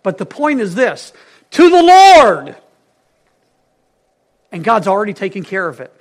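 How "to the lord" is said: loud, high, slow declaration of TO THE LORD; drawn-out preacher's call, pitched high; emphatic proclamation. Real, not acted. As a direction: proclaim it loudly as the whole point. Drives home the sermon's main point.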